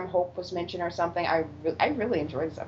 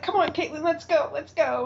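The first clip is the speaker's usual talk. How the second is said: higher-pitched retold plea to leave; hurried urging; 7 semitones higher than usual. Host retells mother rushing her daughter out, mock urgency. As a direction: imitate someone rushing a companion away. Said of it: "trying to rush Caitlin away".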